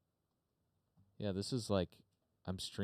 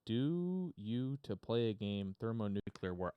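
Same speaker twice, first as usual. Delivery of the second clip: quoting the WarGames computer; 3 semitones higher, slower than usual. Retold or imitated. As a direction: ask it like the movie computer. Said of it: "Oh, war games".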